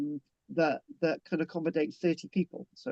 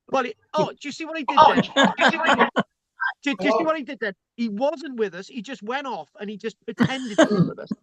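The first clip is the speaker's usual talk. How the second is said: high, loud, gleeful teasing call-out; excited teasing; 7 semitones higher, 5 dB louder than usual. Real, not acted. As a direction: point out the joke with delighted glee. Teasing a frozen participant.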